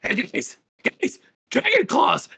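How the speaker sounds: high-pitched kobold warning the others; high, urgent little creature voice; alarmed kobold. Acted. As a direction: alert your buddies in a high creature voice. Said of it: "the one closest to you looks and he's like".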